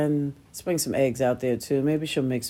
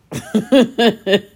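a burst of loud, high laughter; sudden bright laughing fit; real laugh; 7 semitones higher, 7 dB louder than usual. Real, not acted. Laughing at her own mishap.

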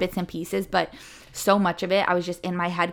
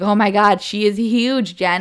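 louder, excited gasp of amazement; amazed exclamation; 2 semitones higher, 7 dB louder, slower than usual. Retold or imitated. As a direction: blurt it out in delighted shock. Coworker's amazed reaction at birth.

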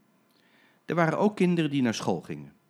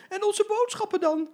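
very high, louder fretful protest; fussing ladies; 6 dB louder than usual. Acted. Ladies fretting to the driver, very high. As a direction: fret about the shopping. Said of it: "riepen alle mevrouwen".

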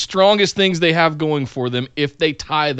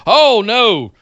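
pleading protest, pitched up; mock dismay; 5 semitones higher than usual. Fans reacting to a wrestler's first promos, mock dismay. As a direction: beg him to stop, hammed-up.

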